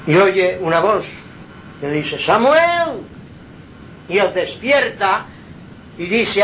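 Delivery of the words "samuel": high, loud call of a name; calling voice of God. Retold or imitated. God's voice calling the sleeping boy, high and loud.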